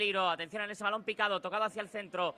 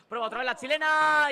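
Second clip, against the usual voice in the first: rising excitement; 10 semitones higher, 8 dB louder than usual. Real, not acted. Chilena attempt before the goal.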